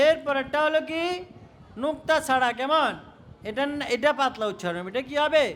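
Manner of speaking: normal talking voice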